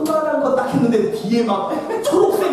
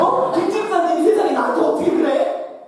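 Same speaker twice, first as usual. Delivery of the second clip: much higher, indignant whine; aggrieved complaint; 8 semitones higher than usual. Retold or imitated. Teacher complaining about Deacon Kim, high and indignant. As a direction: sound hurt and outraged.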